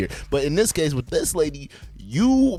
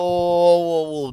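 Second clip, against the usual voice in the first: long drawn-out, raised whoa; interrupting whoa; 3 semitones higher, slower than usual. Real, not acted. Reactor breaking into the played apology, drawn-out interruption. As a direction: halt them with one stretched-out whoa.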